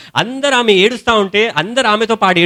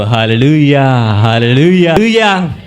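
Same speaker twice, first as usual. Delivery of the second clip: loud, ringing hallelujahs; hallelujah shout; 5 semitones lower, 4 dB louder than usual. Real, not acted. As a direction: call out praise with full force. Preacher exclaims praise. (ఉత్సాహంతో హల్లెలూయా అరుపు)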